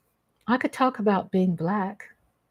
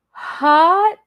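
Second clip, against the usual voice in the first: playful. Real, not acted. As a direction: toss it off teasingly. Playful reaction to chat.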